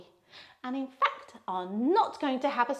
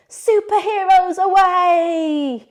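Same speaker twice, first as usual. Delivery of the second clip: much higher, louder, slow triumphant call; superhero call; 6 semitones higher, 11 dB louder, much slower than usual. Acted. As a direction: launch them into the sky like a hero. Superhero pose lift.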